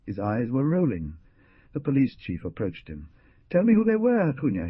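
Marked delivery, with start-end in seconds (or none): 3.5-4.7 loud, high hard demand; threatening growl. A narrator's reading. Police chief to the dying man, menacing demand. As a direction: press him with a threat. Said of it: "he growled".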